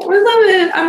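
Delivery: bursting with delight; overjoyed. Real, not acted. Thanking chat for the hearts, high and overjoyed.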